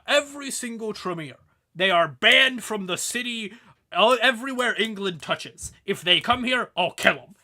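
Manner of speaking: shouting high and loud, outraged; furious roar. Acted. Ancient vampire hears his friend was eaten, loud outraged shout. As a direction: roar the threat at the top of your voice.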